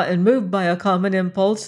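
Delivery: ordinary delivery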